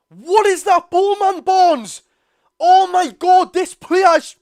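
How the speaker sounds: screaming at the screen in real fury; furious shout. Real, not acted. Fan yelling at his winger on screen, furious shout.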